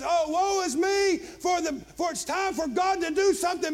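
high, loud preacher's lament; anguished outcry. Retold or imitated. Preacher voices Hezekiah tearing his clothes, anguished outcry. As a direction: cry out in acted despair. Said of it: "he rips his clothes and he says".

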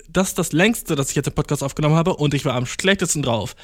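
ordinary talk

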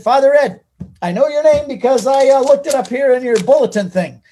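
loud, high-pitched eager greeting; eager introduction. Retold or imitated. New convert introduces himself to the priest, eager and loud.

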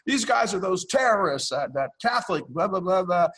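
high-pitched retold yell; imitating a yell. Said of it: "And he yells".

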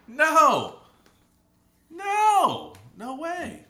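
very high, squeaky refusal; mock outraged refusal. Acted refusal of imagined affair.

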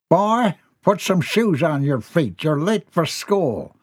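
lightly voiced dad giving orders; ordering father. A narrator's reading. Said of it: "Jeb ordered".

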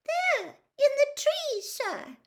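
very high, squeaky little voice; frightened elf. A narrator's reading. House-elf pointing out the tree, frightened and squeaky. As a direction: pipe it up timidly. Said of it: "she whispered".

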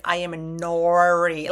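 slowed, spelled-out name for clarity; slow careful introduction. Shown as a demonstration. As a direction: say your name slowly so they get it. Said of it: "usually it's like".

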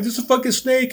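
high-pitched mock holler; imitated yelp of alarm, not truly shouted; retold panicked yell. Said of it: "I'm screaming, I'm yelling".

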